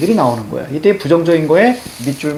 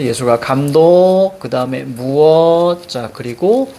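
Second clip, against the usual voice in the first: slow reading, each chunk's ending drawn out; chunked reading demo; 4 semitones lower, slower than usual. Shown as a demonstration. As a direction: read it slowly in chunks, stretching the endings. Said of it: "이렇게 읽어줘야 돼".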